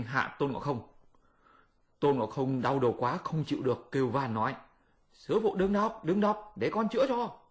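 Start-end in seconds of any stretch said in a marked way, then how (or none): 5.1-7.4 high, pleading monkey voice; pitched-up pleading Monkey King. Acted. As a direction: beg your master to stop the spell. Monkey begs master to stop.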